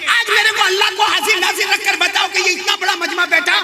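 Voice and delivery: high, hectoring sermon voice; fiery preaching. Real, not acted. Preacher accusing the assembled crowd, fiery. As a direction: challenge the whole gathering, building to a shout.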